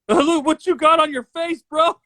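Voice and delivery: high, laughing tease about his face. Real, not acted. Friend teasing co-host about his face filter, laughing.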